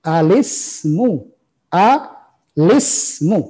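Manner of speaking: Alismu drawn out slowly, lam joined to sin; slow model reading. Shown as a demonstration. Teacher modelling the reading slowly for online students. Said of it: "lihat cara bacanya, dengarkan".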